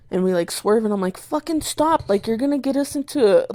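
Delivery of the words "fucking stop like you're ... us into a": high-pitched, quick exasperated telling-off; retold exasperated scolding. Cousin to cousin grabbing at the driver's arm, exasperated. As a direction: retell pleading with someone to stop, pitched up.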